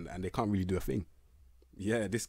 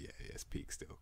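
whispered aside; 14 dB quieter than usual. Real, not acted. Vlogger giving up on a poor player, whispering.

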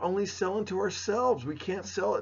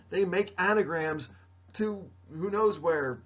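ordinary delivery

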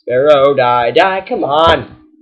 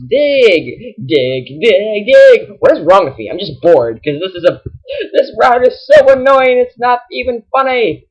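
ordinary talk, nothing marked

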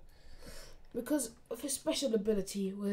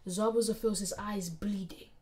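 plain reading voice

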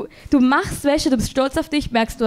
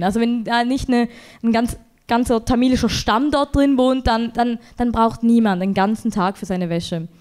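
ordinary talk

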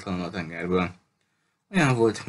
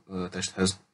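ordinary delivery, nothing marked

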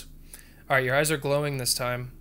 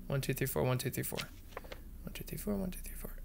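counting under breath; 2 semitones lower, 15 dB quieter, faster than usual. Real, not acted. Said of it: "counting under breath".

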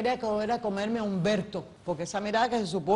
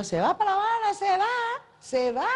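calling out; 11 semitones higher than usual. Retold or imitated. Actress retelling a crew member calling after her, in a high mock call. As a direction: call out as if she's running away.

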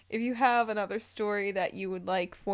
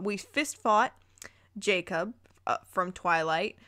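ordinary delivery, nothing marked

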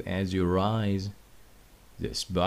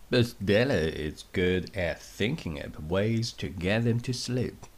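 plain reading voice

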